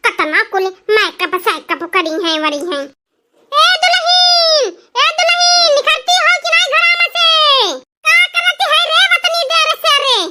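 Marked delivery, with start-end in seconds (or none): 3.5-10.2 calling out. Acted. Calling daughter-in-law out of house.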